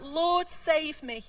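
Lord, save me drawn out slowly as a plea. Shown as a demonstration. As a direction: stretch out Lord, save me like a cry for help. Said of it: "And he cried out".

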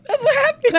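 laughing. Real, not acted. Laughing hard.

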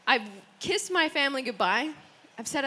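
normal talking voice

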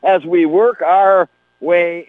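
hyped radio host opener; loud, pumped-up halftime welcome. Real, not acted. Radio host opens halftime show.

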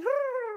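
a burst of high laughter at his own joke; laughing. Real, not acted. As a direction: crack up at yourself. Laughs at own pony bit.

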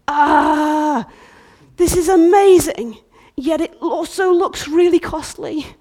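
loud, high cry of awe; loud amazed bride; awestruck exclaim. Acted. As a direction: gasp in wonder then worry.

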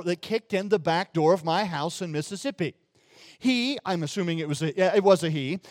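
ordinary talk

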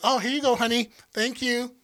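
high, sugary bartender; lightly mocking sweet service voice.

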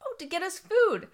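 high little owlet voice; small, high storybook reply; second baby owl. Acted. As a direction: pitch it up like a chick. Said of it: "the second one says".

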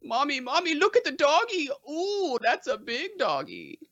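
put-on high childish goofy voice; Adam Sandler voice. Shown as a demonstration. Host reading a quiz quote, goofy high childish voice. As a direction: squeal it up like a big kid. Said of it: "I'll do this one in an Adam Sandler voice".

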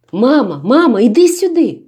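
high, loud call for mother; piercing scream. A narrator's reading. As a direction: scream it out into the dark. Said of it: "пронизливо закричала Янка".

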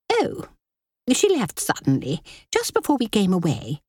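high, snappish society lady; haughty sister-in-law. Acted. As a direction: press with sharp impatience. Linda evasive excuse.

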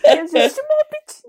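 put-on very high, loud, slow accented squeal; hammed-up Spanish-accented woman; Estefania imitation. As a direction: stretch it out in a shrill mock Spanish accent. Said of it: "It's something like that".

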